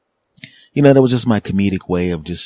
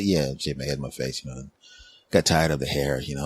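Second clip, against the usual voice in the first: soft, low reply; 6 semitones lower, 5 dB quieter than usual. Retold or imitated. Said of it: "I said".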